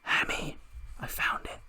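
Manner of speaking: unvoiced sneaky whisper. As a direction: whisper it like a secret find. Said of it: "She's like".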